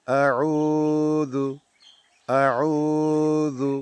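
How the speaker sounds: আউযু said very slowly with the উ held about a second; tajweed demo. Shown as a demonstration. Teacher showing students how to recite আউযু, slowly stretched. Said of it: "এক second টানতে হবে".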